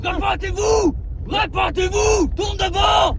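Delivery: high-pitched urgent yelling; shouted orders. Acted. Passenger orders grandpa to turn back, shouting. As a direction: yell directions at the driver.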